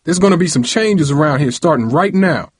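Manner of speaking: heated father's warning; hollering. A narrator's reading. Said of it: "he hollered".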